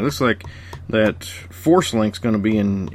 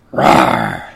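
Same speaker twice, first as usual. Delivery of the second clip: low, loud growl; dinosaur voice; 11 semitones lower, 5 dB louder than usual. Retold or imitated. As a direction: growl like a big beast. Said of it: "Didn't he go".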